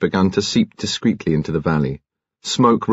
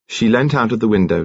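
ordinary delivery

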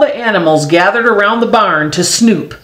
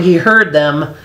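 ordinary delivery

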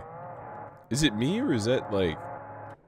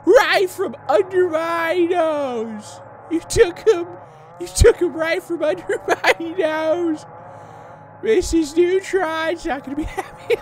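loud, drawn-out, very high cartoon wail; Carl Wheezer; 6 dB louder, slower than usual. Carl upset it was taken from under his nose, hammed-up impression. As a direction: wail in a squeaky kid voice, half laughing. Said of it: "I'm gonna go to Carl Weezer impressions".

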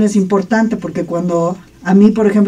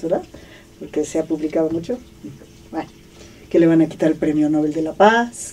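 ordinary delivery

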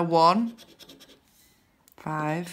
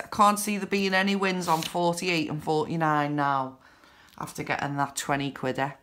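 ordinary delivery

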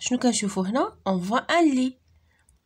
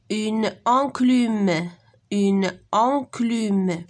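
"Une enclume" drawn out very slowly; slow model reading; much slower than usual. Shown as a demonstration. Teacher modelling "une enclume" slowly for pupils to copy. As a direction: stretch each sound of "une enclume" for the class. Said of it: "lis les mots".

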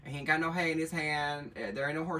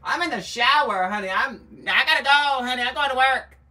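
imitating a friend; 9 semitones higher, 9 dB louder than usual. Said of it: "She's like".

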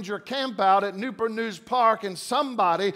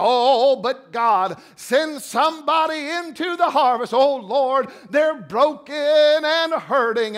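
mock pleading prayer; 2 semitones higher, 4 dB louder, slower than usual. Preacher mocking churchgoers, pleading prayer in a hammed-up voice. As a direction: ham up a dramatic prayer to God. Mocks a showy emotional prayer.

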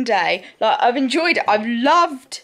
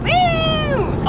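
excited. Real, not acted.

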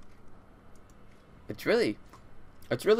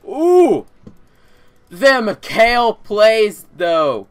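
high, loud, slow hype; excited cheer; 9 semitones higher, 12 dB louder, much slower than usual. Real, not acted. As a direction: cheer the play loudly. Cheering a good play.

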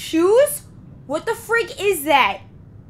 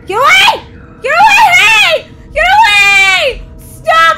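real frantic shouts, much higher and louder; panicked yelling; 13 dB louder, slower than usual. Real, not acted. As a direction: yell at it to get away. Monster attacks in game.

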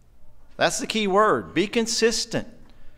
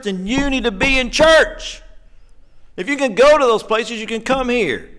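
higher and louder, pushing the point home; fiery preaching; 5 semitones higher, 4 dB louder than usual. Real, not acted. Preacher urging members back to church, fiery and rising. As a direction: preach it hard to the pews.

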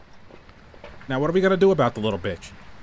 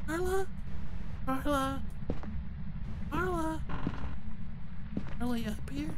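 high, hushed ghost-baiting call; creepy calling; 8 semitones higher, 11 dB quieter than usual. Pretend. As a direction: softly coax a ghost out, pitched high. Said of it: "Maybe I'm being creepy".

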